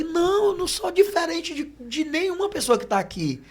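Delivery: retold objection pitched high; emphatic protest. Preacher refusing special treatment from church sister, protesting.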